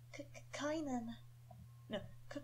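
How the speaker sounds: put-on soft, stammering young boy; nervous stammer. Young Kynan nervously stammering out his own name. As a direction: stumble over the name, nervous. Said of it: "sound young and slightly nervous".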